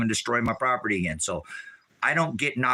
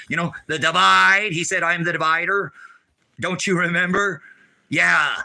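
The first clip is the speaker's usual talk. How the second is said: louder, higher heated rant; 5 semitones higher, 5 dB louder than usual. Real, not acted. Ranting to viewers about the sheriff, raised and heated. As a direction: push it at the audience.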